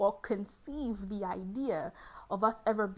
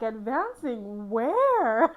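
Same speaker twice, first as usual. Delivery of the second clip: mock baffled question; 7 dB louder, much slower than usual. Confused reply to talk of advancing.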